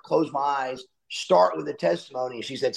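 ordinary talk, nothing marked

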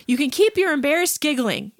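normal talking voice

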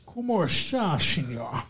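put-on low, soft Spanish in a Scottish accent; Scottish actor half-heartedly playing a Spaniard; Sean Connery. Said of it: "It's exactly how he would do it".

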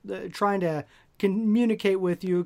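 normal talking voice

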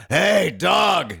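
lightly voiced call to a dog; a quick imitation of a stranger hollering; retelling a homeless man calling to the dog; a hint of a street guy.

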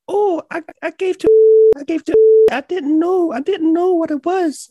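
loud, very high-pitched imitation of an older woman. Coworker explaining what she did with the vape, very high-pitched imitated voice. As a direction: squeal the excuse in a tiny, piercing voice. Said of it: "she has this very high-pitched voice".

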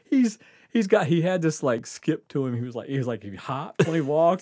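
normal talking voice

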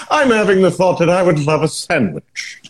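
a refined Brit dreaming of a sandwich, giggling; high British cultured voice. Acted. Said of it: "a very high British cultured voice".

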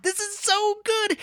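high, slow, gleeful squeal; gushing delight. Acted. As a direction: relish it, stretching high on SO. Delighted aside to scribe.

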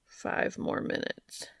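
slow, drowsy mumble under the breath; half-asleep boyfriend begging to stay in bed; sleepy protest. A narrator's reading. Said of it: "he protested".

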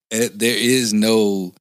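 normal talking voice

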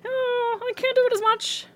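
high-pitched, hesitant little voice; self-doubting quote. Retold or imitated. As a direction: back off in a small high voice. Voicing someone less confident at accents.

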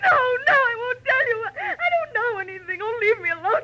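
high, loud hysterical crying. Acted. Louise refuses to talk to Marlowe, crying hysterically. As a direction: sob and shout him away. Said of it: "You're hysterical".

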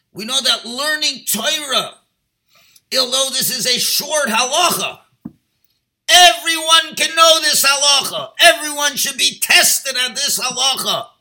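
loud, high, fervent sermon delivery; preaching at full force. Acted. Rabbi exhorting his congregation, fervent loud preaching. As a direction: drive the point home to the congregation. Rabbi urges Kiddush Hashem.

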